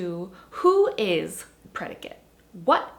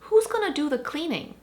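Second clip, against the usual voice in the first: who's gonna do the cleaning? asked high and louder; stress on who's; 7 semitones higher, 4 dB louder than usual. Shown as a demonstration. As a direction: push up on who's. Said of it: "in response to".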